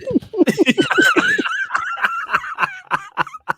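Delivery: very high, howling laugh; helpless high-pitched laughter; shrieking laugh. Real, not acted. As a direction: let out a screaming cackle. Bursts out laughing at "fuckery.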